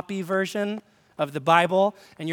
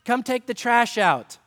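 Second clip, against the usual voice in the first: mom calling out; 6 semitones higher, slower than usual. Retold or imitated. Mom calling kid to chores.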